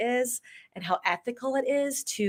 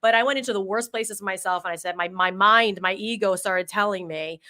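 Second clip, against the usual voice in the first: ordinary delivery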